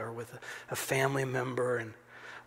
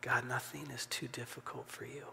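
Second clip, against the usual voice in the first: much softer, hushed prayer; whispered prayer; 10 dB quieter than usual. Shown as a demonstration. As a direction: murmur it privately to God. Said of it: "faith to whisper".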